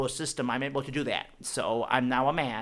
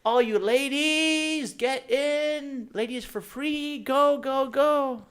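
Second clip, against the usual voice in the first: bouncer yelling; 10 semitones higher, much slower than usual. Retold or imitated. Bouncer waving ladies in with a high Southern holler. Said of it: "The bouncer goes".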